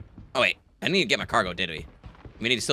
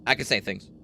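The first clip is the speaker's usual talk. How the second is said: ordinary talk, nothing marked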